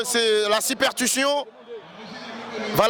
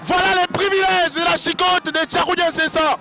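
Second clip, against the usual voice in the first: hyped-up taunting; 4 semitones higher than usual. Acted. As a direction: crow over the whipping. Hyped taunting call of the beating.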